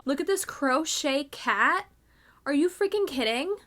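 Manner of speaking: ordinary talk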